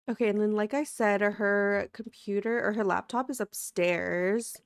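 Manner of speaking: ordinary talk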